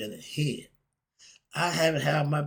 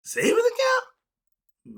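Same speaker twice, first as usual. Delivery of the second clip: very high, slow, squeaky incredulous "Savings account?"; exaggerated high-pitched disbelief; mock disbelief; 6 dB louder, much slower than usual. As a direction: stretch "account" up in mock shock. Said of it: "I don't know what the hell that is".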